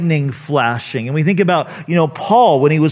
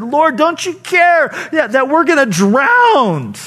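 much higher, pleading cry of frightened disciples; panicked plea; 10 semitones higher, 3 dB louder than usual. Retold or imitated. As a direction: beg like you're about to drown. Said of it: "they woke him up and they said".

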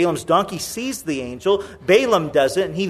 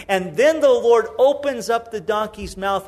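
usual speaking voice

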